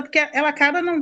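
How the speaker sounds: usual speaking voice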